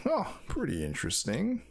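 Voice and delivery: ordinary talk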